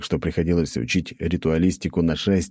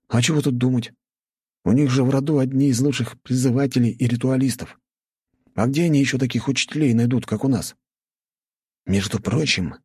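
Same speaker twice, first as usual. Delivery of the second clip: higher-pitched schoolboy friend, matter-of-fact; friend explaining; 7 semitones higher than usual. A narrator's reading. Narrator voices friend explaining. (друг объясняет высоким голосом)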